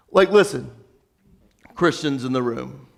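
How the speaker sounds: ordinary talk, nothing marked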